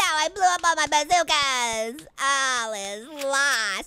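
slow, drawn-out mock lament; mock despair. Player laments the lost bazookas, mock despair. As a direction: wail it out melodramatically, stretching the words.

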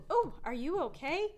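usual speaking voice

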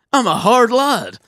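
high, loud boast; mock tough bravado. Recalling himself punished at camp, boasting mock-tough. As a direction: proclaim it like a cocky hard man. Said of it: "I was like".

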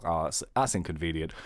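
normal talking voice

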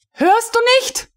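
very high furious yell; high screech. A narrator's reading. Frau von Melin shrieking at the deaf recruit, enraged. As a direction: shriek the question at him.

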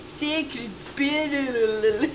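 drawn-out, slow Scottish burr; Scottish accent. Acted. Player relishing the game's Scottish spelling, drawn-out Scottish accent. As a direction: stretch it out with a hammed-up Scottish roll. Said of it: "they spelled it in a Scottish accent".

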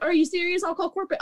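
high-pitched mock outraged customer; indignant. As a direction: imitate an affronted customer making threats. Retold indignant customer threatening complaint.

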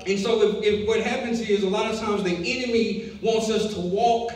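loud, high, driving sermon; full-force preaching. Acted. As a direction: preach it hard to the congregation.

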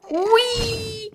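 high, stretched-out squeal; drawn-out whee. Real, not acted. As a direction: let out a long, high whee. Cry while falling or fleeing.

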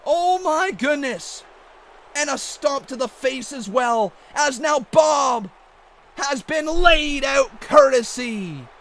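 excited wrestling commentator calling the action; hyped ringside play-by-play; play-by-play commentary. Acted. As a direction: call the match like a ringside announcer.

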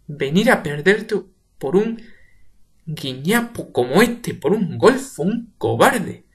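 contemptuous shout; slow furious contempt. Acted. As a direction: spit each insult. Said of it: "sin hacerle caso".

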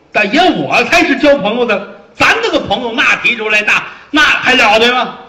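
high, loud bragging; boastful shout. Acted. Fat officer bragging about his friendships, loud and high. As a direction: brag with a laugh in it.

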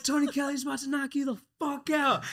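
high, loud mocking shout at a fighter; heckler's yell. Heckler screaming at the fighter walking out, loud high yell. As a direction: bellow it like a heckler in the crowd. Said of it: "It's like".

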